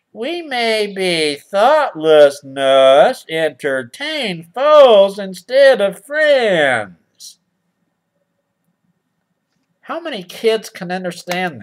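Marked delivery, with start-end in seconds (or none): 0.1-7.4 pompous moral reading. Acted.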